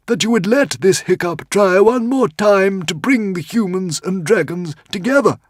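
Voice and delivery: tiny frantic squeaking creature; squeaky little dragon. A narrator's reading. Woden's Fang pleads bravely.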